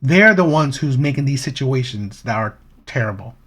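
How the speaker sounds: ordinary delivery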